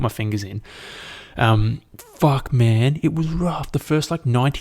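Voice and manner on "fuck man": ordinary talk, nothing marked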